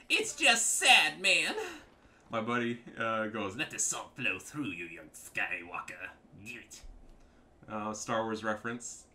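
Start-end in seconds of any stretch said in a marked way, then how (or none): 0.1-1.5 high, loud, slow whine; mock whiny opponent. Player parroting rival's reply to his trolling, high mock whine. 3.6-6.8 slow, slightly lowered villain voice; Star Wars villain. Retold or imitated. Player quoting buddy's Star Wars line, lightly voiced villain.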